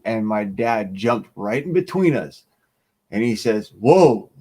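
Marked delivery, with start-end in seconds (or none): 3.8-4.3 a sudden loud "whoa", breaking it up; retold "whoa". Dad jumps between two men, loud whoa. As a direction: call out to stop a fight.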